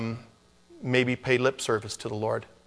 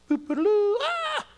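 much higher, silly imitated trumpet toot then a squeal; trumpet blast and shout; slower than usual. Preacher imitating the rapture trumpet and shout, much higher and playful. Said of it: "hear a trumpet and a shout".

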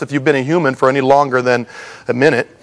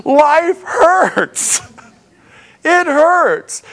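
wailing; 14 semitones higher, much slower than usual. Acted. Preacher wails after quote on wailing.

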